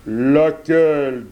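slow, eager wolf question; the wolf asking. A narrator's reading. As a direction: drag it out as a curious wolf. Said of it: "demanda le loup".

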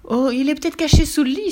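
high, fearful lady's cry; alarmed exclamation. A narrator's reading. Lady fears a Warou under the bed, high alarmed cry. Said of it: "s'écria une dame".

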